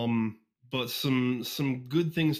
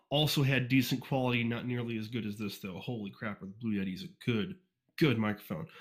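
ordinary delivery

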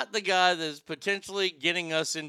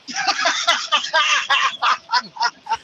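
loud burst of laughter; 9 semitones higher, 8 dB louder than usual. Real, not acted. Host loves his gag reel idea.

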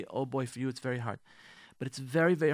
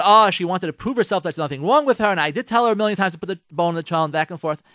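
heated justifying; 5 semitones higher, 10 dB louder, faster than usual. Pretend. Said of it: "arguing spouse".

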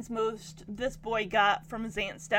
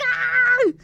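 high, loud panicked scream; a kid's burst of panic; panicked yell; 13 semitones higher, 7 dB louder than usual. Retold or imitated. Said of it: "he's like".

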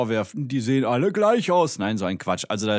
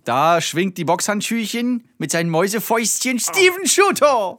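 big, high boxing announcer shout; arena announcer; 9 semitones higher, 6 dB louder than usual. Pretend. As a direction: stretch the fighter's name out. Announcing a mock boxing match.

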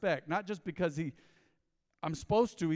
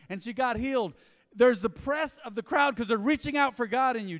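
raised, ringing preacher voice; preaching; 5 semitones higher, 6 dB louder than usual. Acted. Pastor stirring the congregation about the crowd, preaching loud. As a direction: build it like a pulpit point.

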